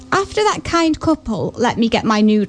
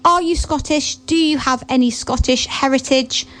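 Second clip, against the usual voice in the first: ordinary talk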